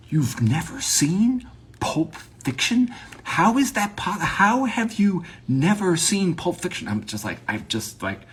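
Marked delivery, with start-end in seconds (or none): 0.1-6.9 high-pitched shocked disbelief; incredulous. Retold or imitated. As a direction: act out an aghast friend, voice pitched way up. Acts out people's shocked disbelief.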